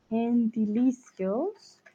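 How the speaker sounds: Gentilicios spelled out slowly and softly as if writing; slow note-taking. Shown as a demonstration. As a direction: sound it out while writing Gentilicios. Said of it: "lo anoto en mi agenda". (Gentilicios, lento y suave, mientras lo escribe)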